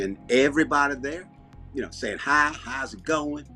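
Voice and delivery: easygoing Southern storyteller; Southern accent. Pretend. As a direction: explain Southern life in a folksy accent. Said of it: "this is the way I talk when I'm down here".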